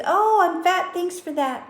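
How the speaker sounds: high, sarcastic mock acceptance; mock sarcastic thanks. To an insult she received, sarcastic thanks. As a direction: fake-cheerfully accept an insult. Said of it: "you said".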